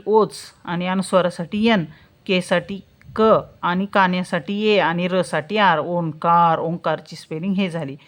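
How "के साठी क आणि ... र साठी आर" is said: normal talking voice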